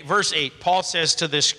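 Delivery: ordinary delivery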